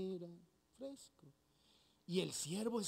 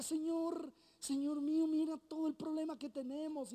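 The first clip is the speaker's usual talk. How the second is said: higher, worried servant pleading; panicked servant; 7 semitones higher than usual. Acted. Servant warning Eliseo about the enemy army, higher and anxious. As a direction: alarm at the surrounding army.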